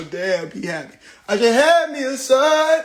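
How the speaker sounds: usual speaking voice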